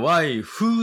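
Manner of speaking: "ワイフ" loud and slow, with a full "fu" ending; wrong added u in "wife". Shown as a demonstration. Teacher showing the error, "ワイフ" with voiced final u. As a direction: say "ワイフ" katakana-style, stretching the final u. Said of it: "Uを最後つけないように".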